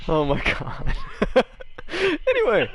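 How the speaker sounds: high, breathless laughter; laughing. Real, not acted. Game master recovering from a fit of laughter, laughing. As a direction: giggles fading into getting back on track. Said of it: "GM laughing hard".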